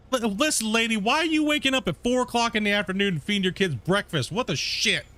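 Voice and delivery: high, exasperated outburst at the seller; fed-up buyer venting about the lady; heated rant. Real, not acted. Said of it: "I'm getting all heated now".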